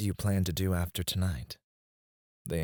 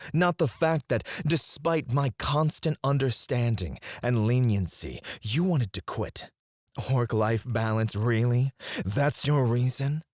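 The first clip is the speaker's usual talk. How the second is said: higher-pitched, affronted disbelief; wounded boss challenging a resignation; indignant; 5 semitones higher than usual. Acted. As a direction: throw their reason back at them, incredulous. Boss indignant that assistant quit.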